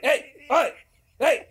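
high loud hey, hey; stain calling out. Retold or imitated. Retelling the Tide ad stain shouting hey, high and loud. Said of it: "and he's like".

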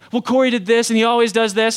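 loud, whiny protest to dad; bickering brother. Retold or imitated. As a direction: complain loudly like a kid brother. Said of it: "Casey's like".

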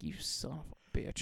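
hissed whispered curse. Retold or imitated. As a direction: mutter it through your teeth. Cursing Perry under breath.

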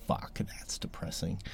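very quiet, slow near-whisper; dejected reaction. Retold or imitated. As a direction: let the voice sink away, deflated. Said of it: "now I'm just like".

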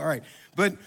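ordinary talk, nothing marked

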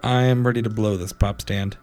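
ordinary talk, nothing marked

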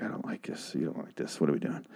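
voiceless whisper of a worried note; whispered quote. Retold or imitated. Character's note to Daryl, whispered. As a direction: breathe it out secretly. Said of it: "she's like".

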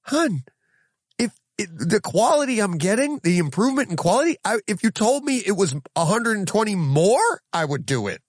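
high, eager case to the wife; excited pleading. Retold or imitated. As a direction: sell it to her eagerly. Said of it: "I go".

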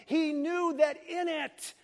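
high, drawn-out preaching; impassioned preaching. Real, not acted. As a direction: proclaim it with slow, high emphasis.

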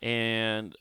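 ordinary delivery

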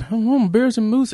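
put-on slow high Canadian accent. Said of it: "I can't do a Canadian accent".

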